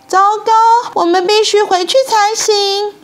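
put-on high, alarmed child-like call; crying out. A narrator's reading. Child realises the swimsuit is forgotten, high alarmed cry. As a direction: exclaim in dismay, pitched high. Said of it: "萨奇大叫".

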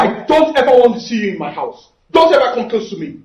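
loud, firm rejection; angry warning. Acted. Son loudly warning the bride never to come near. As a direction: warn her off for good.

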